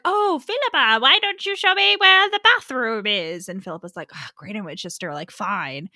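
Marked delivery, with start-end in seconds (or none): 0.1-3.5 a light put-on great aunt, higher and sweet; high sweet old aunt voice. Tommy in disguise coaxing Philippa, high sweet aunt voice. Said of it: "she's like". 4.1-5.9 annoyed. Retold or imitated. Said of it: "so annoyed with her".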